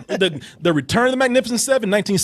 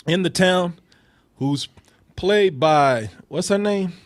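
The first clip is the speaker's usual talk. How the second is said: normal talking voice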